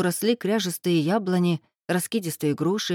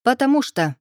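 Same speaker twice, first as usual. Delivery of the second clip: higher, curt dismissal; curt cut-off; 6 semitones higher, 4 dB louder than usual. A narrator's reading. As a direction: shut the question down. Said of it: "отрезала Ирка".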